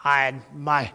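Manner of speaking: ordinary talk, nothing marked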